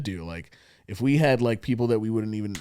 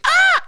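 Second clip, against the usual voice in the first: loud high screeching caw; cawing like a crow; 8 dB louder than usual. Retold or imitated. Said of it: "he's like".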